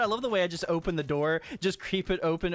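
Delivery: ordinary talk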